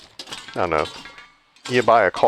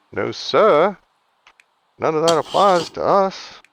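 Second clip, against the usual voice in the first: a lightly put-on smug politician voice, higher; hint of a sly politician, pitched up; mock politician; 7 semitones higher than usual. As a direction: play a lightly voiced crooked politician swearing innocence. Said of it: "I can't even say that without laughing".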